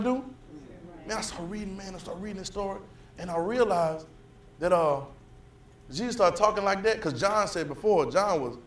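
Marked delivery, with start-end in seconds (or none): none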